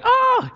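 put-on shrill little-girl scream, very high and loud; startled child's scream; high girlish scream. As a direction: let out a squealing shriek like a scared kid. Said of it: "I scream like a little girl".

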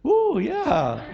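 high-pitched sarcastic cheer; mock enthusiasm. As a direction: whoop it in fake delight. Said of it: "are you gonna go like".

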